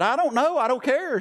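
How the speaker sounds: high, frantic brush-off; panicked. Retold or imitated. To the buddy asking what to say on the radio, panicked and high. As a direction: toss it off in rising panic. Panicked retort on failing plane.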